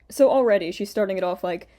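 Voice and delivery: ordinary delivery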